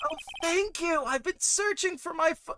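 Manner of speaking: put-on bright high-pitched grateful girl; high sweet character voice. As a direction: thank them in a high sweet voice. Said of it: "do you wanna take the bad guy".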